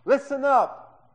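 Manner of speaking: high, drawn-out furious yell; angry shout. Acted. Older son demanding his father's attention, shouting angrily. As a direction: yell it full force at your father. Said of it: "let me cover the microphone".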